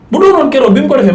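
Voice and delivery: normal talking voice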